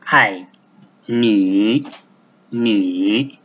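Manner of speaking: "Ni" low and dipping, Mandarin third tone. Shown as a demonstration. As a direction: sink "Ni" low then lift it. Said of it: "like this".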